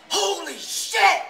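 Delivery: slow, very high, loud curse; drawn-out shout. Retold or imitated. Dad hears his son could die and shouts in shock, slow and high. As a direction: let it rip in shock.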